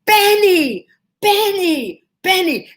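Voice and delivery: high, loud calls after a car; screaming his name. Retold or imitated. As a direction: yell the name to stop the limo.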